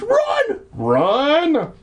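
high, throat-straining hammed-up scream. Boy screaming at his dog to escape. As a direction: shriek it over and over. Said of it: "I screamed".

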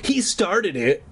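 high, slow accusing protest; mildly acted high-pitched complaint; called out. A narrator's reading. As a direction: call it out like a tattling brother. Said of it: "I called out".

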